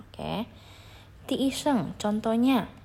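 usual speaking voice

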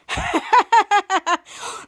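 loud, rapid acted cackle; put-on staccato laughing; mock villain laughter. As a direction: imitate bad guys laughing in triumph. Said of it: "While they were shouting and laughing".

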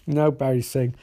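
ordinary delivery

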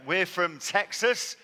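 slow, loud, drawn-out Texan twang; mock Texan drawl. Joking to a wet British crowd, a mock Texan drawl. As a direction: ham up a Texan drawl on Texas. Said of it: "this is not a Texan accent".

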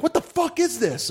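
very high-pitched, scandalized demand; hammed-up indignant outburst; mock outrage. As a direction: play the appalled friend walking in. Voices outraged friend in bit.

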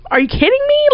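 very high, incredulous outrage; pissed off. Retold or imitated. Storyteller reacting to friends taking the car, pissed off. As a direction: replay the outrage with pitch shooting right up. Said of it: "pissed off".

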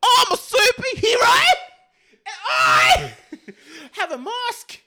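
very high, loud mock yell, easing at the end; Billie Kay. Host doing one wrestler in another's voice, hammed-up shriek. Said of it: "in the voice of Billie Kay".

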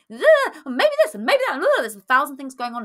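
a high, rushed, anxious inner chatter; high, fast anxious voice. Shown as a demonstration. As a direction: play the paranoid head voice spinning out. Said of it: "It's very high pitched, it's very fast".

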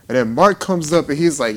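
ordinary talk, nothing marked

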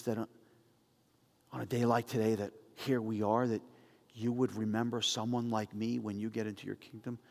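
dying thief begging to be remembered; humble plea. Acted. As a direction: plead quietly, unsure of the answer. Repentant thief pleads with Jesus.

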